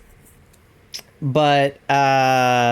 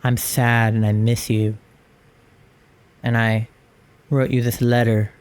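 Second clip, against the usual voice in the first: lower, slightly deeper mopey voice; a hint of emo; 5 semitones lower than usual. Shown as a demonstration. A sad teen writing to a lost love, low mopey emo. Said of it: "what's an emo thing to say".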